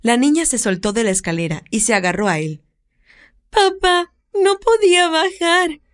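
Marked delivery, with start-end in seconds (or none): 3.5-5.8 put-on high, slow, tearful little girl. Little girl tells her father through tears, high and slow. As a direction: speak near tears like a child. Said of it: "le dijo con lágrimas en los ojos".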